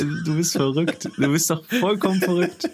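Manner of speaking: high, mock-outraged disbelief; mock outrage. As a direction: play the scandalised friend.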